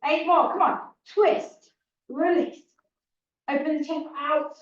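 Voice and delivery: loud, high rep countdown; aerobics instructor. Acted. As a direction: pump up the class to finish. Pushes class through final reps.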